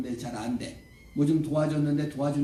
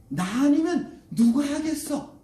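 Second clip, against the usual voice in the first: raised, slower rhetorical challenge; rousing self-appointed hero; 10 semitones higher, 6 dB louder, slower than usual. Acted. Moses resolves to save enslaved Israel, bold raised challenge. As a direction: proclaim it high and loud.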